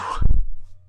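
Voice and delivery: loud sudden laugh; amused real laugh; burst of laughter. Real, not acted. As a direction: laugh out at himself. Laughs at own remark.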